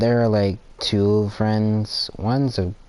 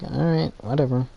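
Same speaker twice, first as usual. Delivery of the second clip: mock dismissive; 6 semitones higher than usual. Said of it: "I'll go like".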